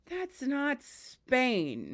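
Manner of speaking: pained, drawn-out disbelief; audible pain. Retold or imitated. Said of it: "in audible pain".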